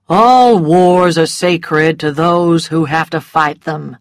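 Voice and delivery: slow, unbothered drawl; lazy dismissive gentleman; bored Rhett brushing off a challenge. A narrator's reading. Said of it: "in a bored and lazy voice".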